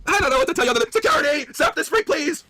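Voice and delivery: high, squealed appeal for help; manager calling security. Acted. Manager calling security on the customer, high frantic voice. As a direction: call out in a silly high manager voice. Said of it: "I'll be the manager".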